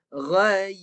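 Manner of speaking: "Ghai" firm and loud, y clearly closed. Shown as a demonstration. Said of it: "Dengar bunyi ya mati tu".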